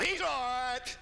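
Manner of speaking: high, blunt snapped question; grumpy mother-in-law. Retold or imitated. Mother-in-law answering son-in-law's call, high and curt. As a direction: answer the phone with a snap. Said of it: "how's Brother Walt".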